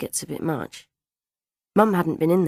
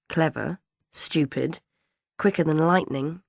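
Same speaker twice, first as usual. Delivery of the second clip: ordinary delivery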